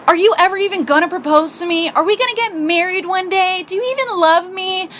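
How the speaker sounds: high-pitched, melodramatic demand; super dramatic. Retold or imitated. Girlfriend arguing with boyfriend about marriage, super dramatic. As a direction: plead as if it were a tragedy. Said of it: "I'm all, like, super dramatic".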